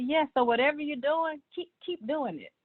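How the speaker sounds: ordinary talk, nothing marked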